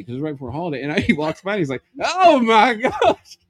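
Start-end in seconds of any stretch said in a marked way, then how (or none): 2.0-3.4 high, loud, slowly stretched cry; loud dismay. Acted. Inspector reacts to the meat case, loud stretched dismay. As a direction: act out a horrified, carrying exclamation. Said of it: "You couldn't hear him like that".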